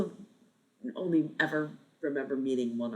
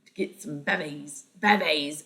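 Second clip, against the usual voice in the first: attempted Australian accent; 2 semitones higher than usual. Shown as a demonstration. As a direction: have another go at Australian slang vowels. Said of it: "in an Australian accent".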